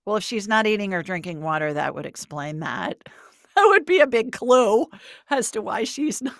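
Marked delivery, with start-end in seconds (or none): 3.6-6.3 high, loud, laughing through the words. Real, not acted. Psychic teasing a caller about her cat, laughing. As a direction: say it while really cracking up. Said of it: "I'm sorry I'm laughing".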